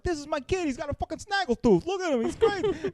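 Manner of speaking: hammed-up high-pitched proud dad; very excited. Dad showing his son to the room, in mock high excitement. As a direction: show off your kid in a very high voice. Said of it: "he appears to be very excited".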